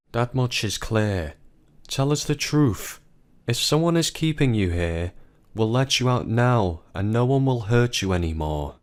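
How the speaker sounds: ordinary delivery, nothing marked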